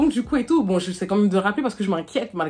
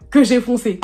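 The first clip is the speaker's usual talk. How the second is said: exclaimed; 3 semitones higher, 7 dB louder than usual. Retold or imitated. Retelling how she drove off.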